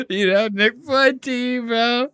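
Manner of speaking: high, slow, doting voice; high gushing. Real, not acted. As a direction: gush over him in a high pitch. Gushing at freeze frame.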